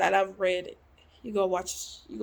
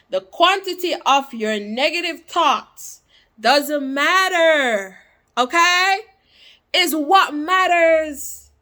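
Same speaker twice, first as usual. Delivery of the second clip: loud, higher, emphatic delivery; forceful reread; 5 semitones higher, 7 dB louder than usual. Real, not acted. Reader giving viewers the quote a last time, forceful. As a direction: hammer the quote home.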